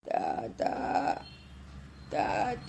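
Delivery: soft, eerie drawn-out call; hushed spirit voice; ghostly child calling. Acted. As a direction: call faintly from beyond. Spirit of dead grandson calls.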